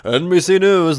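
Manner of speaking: high, loud anchorman imitation; newscaster voice. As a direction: boom it out like a TV anchor. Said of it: "the most newscaster voice you've ever heard".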